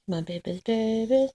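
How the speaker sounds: ordinary talk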